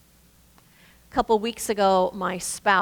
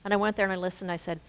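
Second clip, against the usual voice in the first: normal talking voice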